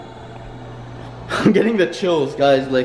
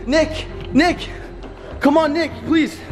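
terrified cries that a demon is behind him; frantic screaming warning; 8 semitones higher than usual. Acted. Pleading with possessed friend.

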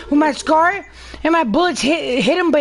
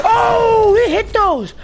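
sudden high excited shout; 8 semitones higher, 4 dB louder, much slower than usual. Real, not acted. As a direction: yell it out, thrilled. Excited reaction during game.

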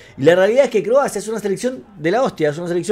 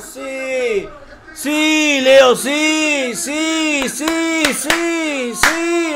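high, softer, repeated cries; spent goal celebration; 4 semitones higher, 6 dB quieter, much slower than usual. Real, not acted. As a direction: keep cheering high as the force drains away. Sigue festejando el gol. (festejo agudo que se va apagando)

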